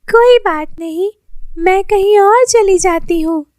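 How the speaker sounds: put-on poor girl asking Lily for clothes, high pleading voice. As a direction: ask timidly like a poor child. Poor girl turns away.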